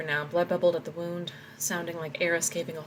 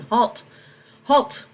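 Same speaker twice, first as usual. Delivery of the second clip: loud, higher shout of command; screaming halt; 6 semitones higher, 9 dB louder than usual. Acted. Boy soldier screaming at the charging old man, loud. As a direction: bark it like a young soldier.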